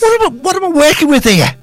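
very high-pitched comic exasperation; squeaked-up, hammed-up dismay; mock despair. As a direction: plead in pretend disbelief at your colleagues.

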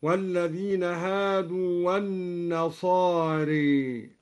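النصاري with the final alif bent fully toward ee; slow recitation leaning النصاري into -ri; imala kubra. Shown as a demonstration. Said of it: "قرأ الدوري بإمالة الألف بعد الراء إمالة كبرى". (إمالة كبرى في النصاري)

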